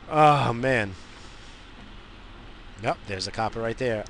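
normal talking voice

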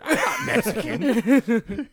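high, slow, loud mocking whine; mock denial. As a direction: stretch it out in a teasing whine.

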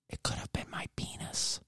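hushed whisper, no voice at all. Real, not acted. Host confiding the worse injury he avoided, whispered.

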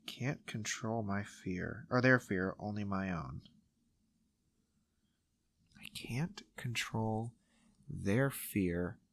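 slow, soft whisper; whispered reread. Real, not acted. Host whispers the quote back while checking it, in a slow whisper. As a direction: murmur the quote slowly to yourself.

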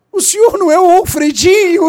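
amazed caralho; surprised exclamation. Retold or imitated. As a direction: caught off guard by recognition. Suspect asks if he's Alfredinho.

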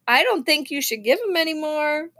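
plain reading voice

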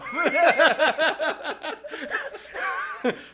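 long, high peals of laughter; laughing. Real, not acted. Teller cracking up at his own impression, high laughter. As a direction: burst out in high, helpless laughter.

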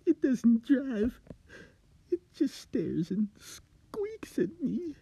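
high, slow, breathless whisper of terror; a scared man hissing his secret; trembling high whisper; frightened whisper. Acted. Frightened Steve voiced in story.

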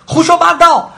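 high, loud and decisive; firm loud shout. A narrator's reading. Alice boldly shouting back at the Queen, loud and firm. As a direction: shout her down without hesitating. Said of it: "爱丽丝果断地大声喊道".

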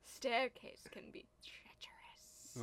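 slow whispered warning; hushed, drawn-out spooky quote; eerie film impression. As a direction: whisper it slowly and ominously. Quoting the film's eerie line.

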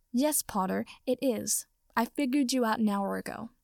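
usual speaking voice

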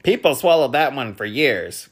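spelling bee voice. A narrator's reading. Spelling bee commenting on the cake, high and loud. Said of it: "commented the spelling bee".

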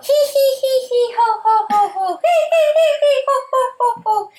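squeaky high giggle, hee and ho; high cackling hee hee ho ho. Retold or imitated. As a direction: cackle it like a cartoon bug. Acting out Beetle Ball laugh.

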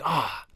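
whispered "ah"; airy sigh, as if finally there; sigh of arrival. Acted. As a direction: breathe out a blissful sigh of relief. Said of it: "In that moment, like".

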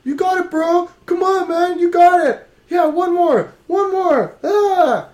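loud sharp voice; yelling spotter. Retold or imitated. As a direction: bellow encouragement at the lifter. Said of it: "Why are you yelling".

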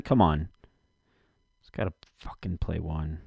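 ordinary delivery

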